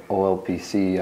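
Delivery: ordinary delivery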